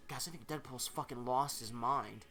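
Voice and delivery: ordinary delivery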